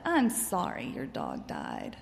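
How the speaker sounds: low, slow, gentle condolence; sympathetic. Shown as a demonstration. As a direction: offer soft, unhurried sympathy. Demonstrating sympathy to grieving child.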